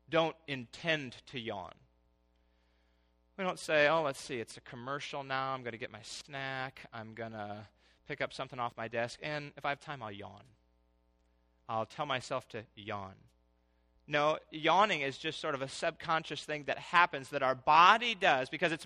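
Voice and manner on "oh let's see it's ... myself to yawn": softer, lower mock planning voice, half to himself; mock self-talk. Preacher mimics someone planning a yawn, softly. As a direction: play the man scheduling his own yawn.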